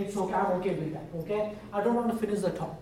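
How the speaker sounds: ordinary talk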